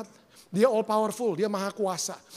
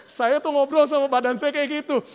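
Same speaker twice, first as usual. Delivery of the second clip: animated; 5 semitones higher, 6 dB louder than usual. Real, not acted. Animated aside after acted dialogue.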